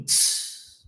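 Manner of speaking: voiceless tss like a cymbal; cymbal hiss. Shown as a demonstration. Teacher imitating a cymbal, voiceless tss. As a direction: hiss it out like a hi-hat. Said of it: "aquele prato da bateria".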